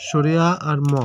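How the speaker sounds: ordinary talk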